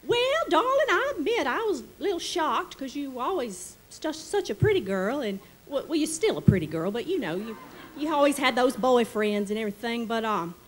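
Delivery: high-pitched delighted Delta lady; Southern lady voice. Retold or imitated. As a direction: play the gushing Mississippi hostess. Charlotte reacts, thrilled.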